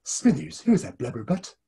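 lightly voiced sneering old boss; a hint of a scornful miser calling his assistant; Mister Burns. Showing off a favourite impression, a light Burns sneer. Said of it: "I like doing Mister Burns".

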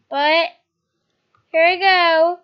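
ordinary delivery

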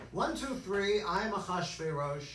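normal talking voice

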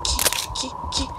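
breathless high whispered key; hissed panicked key key; frantic whisper. Real, not acted. Frantic search while hiding.

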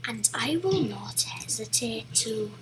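whispered villain promise; menacing whisper. Acted. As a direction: breathe the threat quietly. Whispered threat to enemies.

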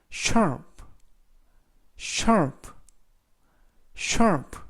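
"sharp" said as a separate word, falling tune; teacher's drill of "sharp", evenly repeated; pronunciation model. Shown as a demonstration. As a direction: model "sharp" clearly for learners. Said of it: "라고 발음하시면 되겠고요".

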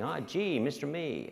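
gushing, high-pitched American lady; American accent. Retold or imitated. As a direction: imitate an effusive American woman caller. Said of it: "lady with an American accent".

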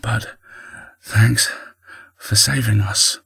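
halting, hesitant thanks; nervous stutter. A narrator's reading. Argy thanking the sphinx who saved them, stuttering. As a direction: leave gaps between the words, hesitantly. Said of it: "he stuttered".

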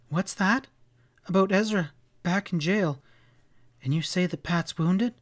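understated rising panic in a woman's voice; mildly hysterical. A narrator's reading. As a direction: seize on the news in alarm. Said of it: "Sally's voice rose hysterically".

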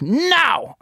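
put-on high, drawn-out toddler refusal; imitated fussy toddler. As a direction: mimic a small child digging in. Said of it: "she gets sick of FaceTime".

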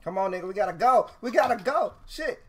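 urgent shouting; yelling. Real, not acted. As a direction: yell at him to hurry up. Urging friend to leave.

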